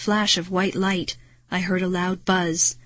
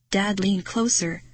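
ordinary delivery, nothing marked

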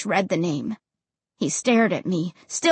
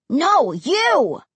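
put-on raised, drawn kid's refusal; bratty, higher-pitched comeback; stubborn retort; 5 semitones higher, 3 dB louder than usual. A narrator's reading. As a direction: throw it back stubbornly. Stubborn sibling argument.

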